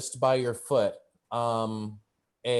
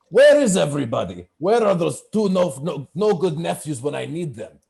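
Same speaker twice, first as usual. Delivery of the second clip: loud, raised voice of an irate orc uncle; big orc boss; 5 semitones higher, 8 dB louder than usual. Acted. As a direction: holler for the missing help. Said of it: "And he's just like".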